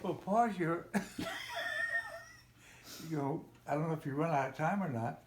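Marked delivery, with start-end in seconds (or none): 0.9-2.2 real laugh. Real, not acted. Father laughing at the child's remark.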